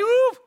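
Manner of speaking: high, loud swooping 'uf'; high whoop. Acted. Wife lunges at José, high loud whoop. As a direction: let out a sudden rising whoosh.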